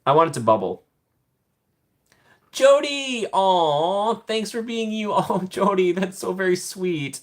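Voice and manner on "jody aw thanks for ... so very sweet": high-pitched gushing thanks; touched gratitude. Real, not acted. Host thanks a viewer for a kind gift, touched and gushing. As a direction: gush with surprised affection, much higher.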